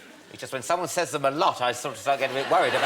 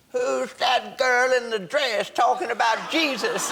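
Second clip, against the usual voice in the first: mock American heckler; 9 semitones higher, slower than usual. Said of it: "Aren't they a bit like".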